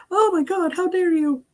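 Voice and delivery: high prissy offended voice; mock outrage. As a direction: clutch your pearls. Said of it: "They just don't go".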